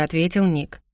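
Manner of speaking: ordinary delivery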